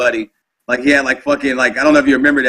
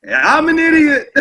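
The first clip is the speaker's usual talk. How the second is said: much higher self-mocking confession; mock sheepish admission; 12 semitones higher than usual. Admitting to Gunny, much higher, sheepish mock confession. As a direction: own it like a guilty kid, pitched up.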